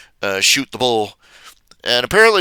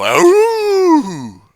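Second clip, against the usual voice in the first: much higher yelp of ow; mock ow; 4 dB louder than usual. Co-hosts teasing Ashley, high mock yelp. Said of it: "where they "ow" at her".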